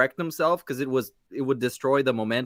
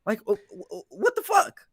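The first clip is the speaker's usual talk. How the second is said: shrill, high mock outburst; mock disbelief; 7 semitones higher than usual. Podcaster acting out the streamer's shocked reaction. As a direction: pitch up into what the fuck.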